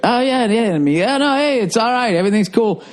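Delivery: high, eager smoothing-over; brushing off the psycho to get out; placating. Retold or imitated.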